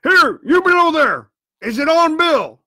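loud, high hailing shout; crying out. A narrator's reading. Blind man calls to men below, shouting the question. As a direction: holler to those below. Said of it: "cried the blind man again".

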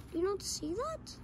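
nervous hushed asking; uneasy, hushed question. Acted. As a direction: ask it quietly, afraid of the answer. Nervously asks friends about a shadow.

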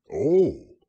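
a loud, happy "oh" of understanding; happy Pooh. A narrator's reading. Pooh learns it means food, happily. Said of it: "said Pooh happily".

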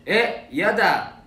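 loud, drawn-out no; playful refusal. Real, not acted. Idol turns down a fan asking his hair colour, playful refusal. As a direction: refuse slowly and loudly, teasing.

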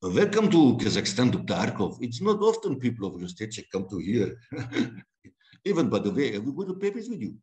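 a light Russian accent, rolled and heavy. Shown as a demonstration. Russian accent performed on request for interviewers. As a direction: play the villain greeting the doctor, lightly Russian. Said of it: "could you give us a quick rendition of it".

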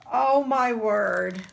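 ordinary talk

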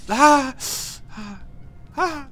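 high short yelps of alarm; startled yelps. Real, not acted. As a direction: yelp in a burst of fright. Falling in game. (hohe erschrockene Schreie)

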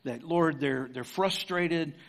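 prayer. Real, not acted. Closing prayer.

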